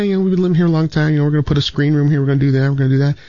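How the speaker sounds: high, fast imitating a young woman; lightly put-on high girl voice, quick. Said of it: "She's like".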